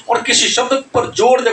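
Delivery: ordinary delivery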